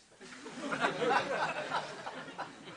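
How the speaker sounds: airy, breathy burst of laughter. Real, not acted. Speaker laughing at the Christmas trousers punchline. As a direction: laugh through the breath at your own gag.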